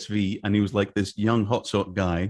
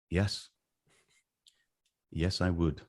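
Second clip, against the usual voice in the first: trying to stay calm; 6 semitones lower, 8 dB quieter, slower than usual. Retold or imitated. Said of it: "trying to keep as calm as I could".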